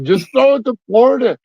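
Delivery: high-pitched yell at the TV; retold TV screaming. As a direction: retell an exasperated fan's shout at the screen. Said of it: "screaming at the T V".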